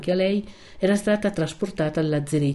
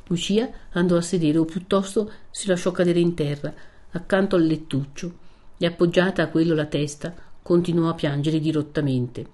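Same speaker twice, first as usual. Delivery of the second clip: ordinary delivery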